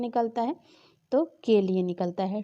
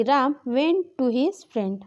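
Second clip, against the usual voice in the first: slow dictation; 2 semitones higher, much slower than usual. Shown as a demonstration. Teacher reading an example sentence to students, slow. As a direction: read the English slowly, word by word.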